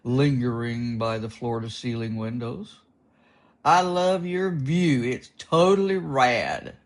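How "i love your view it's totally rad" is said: surfer dude voice. Acted.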